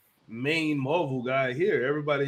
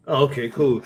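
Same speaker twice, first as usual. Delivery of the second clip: ordinary delivery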